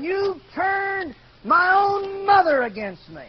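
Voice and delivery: loud, high, slow, drawn-out shout; retold hysterical scream. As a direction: scream it as a near-hysterical mother. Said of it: "And she was screaming".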